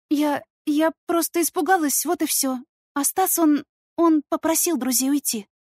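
put-on high, hesitant girl's voice; nervous, frightened girl. A narrator's reading. Stepdaughter covers for her stepbrother while upset, high and nervous. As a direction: stammer an excuse in a high, anxious voice.

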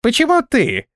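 high-pitched indignant challenge. A narrator's reading. Flyer challenges the self-made priestess, indignant. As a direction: protest in a high, offended voice. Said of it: "возмутился летун".

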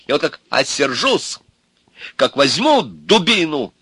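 high, loud boastful tirade; worked-up indignation. A narrator's reading. Ivan telling Milka off and threatening her, indignant. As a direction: rant indignantly, puffing yourself up. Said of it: "Иван всё больше накалялся".

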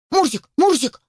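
high-pitched anxious puppy calls; worried puppy. A narrator's reading. Puppy calls to his climbing friend, worried. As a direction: call out in a puppy's worry. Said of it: "забеспокоился щенок".

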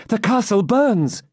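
high, drawn-out cry of dread; panicked, high-pitched heroine; alarmed cry. A narrator's reading. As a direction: raise the alarm in desperation. Rebecca sees the castle on fire.

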